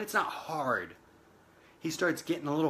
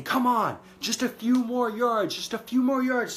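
higher, louder cheering on the last yards; urgent coaching shout; 7 semitones higher, 4 dB louder than usual. Retold or imitated. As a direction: yell him over the line like a coach. Coach pushing player to finish.

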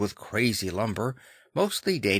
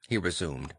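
ordinary delivery

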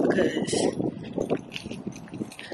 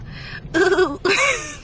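tearful laugh; 8 semitones higher than usual. Real, not acted. Laughing through tears.

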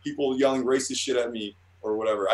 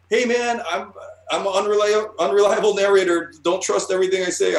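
high, loud and cocky; brash self-quote, raised voice; mock defiant shout; 7 semitones higher, 5 dB louder than usual. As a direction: throw it back at the critics, loud and high. Jokey self-quote to critics.

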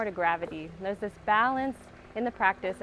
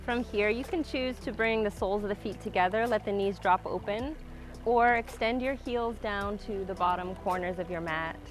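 calm grounding exercise. Acted. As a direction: guide the breath, calm and unhurried. Guides a slow breathing practice.

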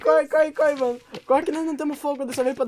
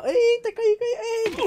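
startled; 11 semitones higher, 5 dB louder, slower than usual. Real, not acted. Fell in game.

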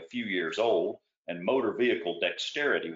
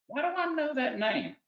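much higher voice for Warwick, puzzled; a different, higher voice wondering aloud; puzzled question. A narrator's reading. Warwick wonders about the name, higher puzzled voice.

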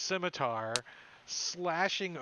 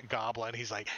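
ordinary delivery

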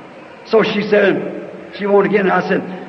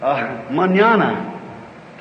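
slow lazy mañana; 7 semitones lower, 4 dB quieter, much slower than usual. Retold or imitated. Retold caller stalling in Spanish.

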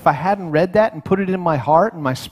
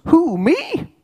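startled high-pitched squeak, far above usual; squeaky, raised voice; 11 semitones higher than usual. Acted. Preacher plays Gideon answering the angel, squeaky and high. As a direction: let the voice leap up into a squeak. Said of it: "I can hear his voice go up a little bit".

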